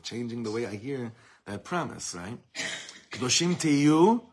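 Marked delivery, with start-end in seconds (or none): 3.1-4.2 loud, high and slow, "Kedoshim tiyu" sung out with delight; joyful. Pretend. Teacher showing students the joyful promise. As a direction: announce it like wonderful news.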